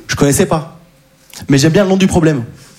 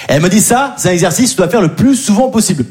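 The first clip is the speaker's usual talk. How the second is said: usual speaking voice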